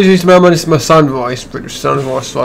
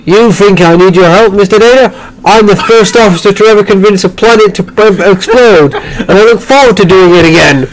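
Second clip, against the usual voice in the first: higher, brash officer's boast; cocky first officer; 4 semitones higher, 4 dB louder than usual. Acted. First officer scoffing at Mister Data's help, cocky. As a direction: boast brightly up in pitch.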